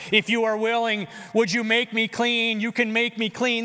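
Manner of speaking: a kneeling man's earnest plea; pleading cry. Acted. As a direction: beg the healer for mercy. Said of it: "This is what he cries out to Jesus".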